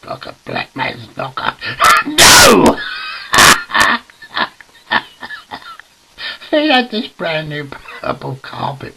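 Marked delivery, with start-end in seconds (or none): 2.1-2.8 high, loud yelled no; hammed-up shout; comic outburst. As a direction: yell it out in mock outrage. Comic outburst after joke.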